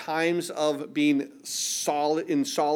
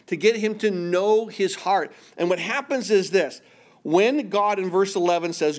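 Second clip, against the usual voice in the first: ordinary delivery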